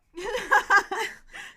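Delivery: very loud burst of laughter. Real, not acted. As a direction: howl with laughter. Huge laugh.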